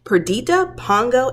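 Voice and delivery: ordinary delivery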